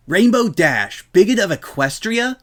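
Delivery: high, loud outraged yell; yelled in disbelief. A narrator's reading. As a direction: shout the headline back in shock. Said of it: "Rainbow yelled".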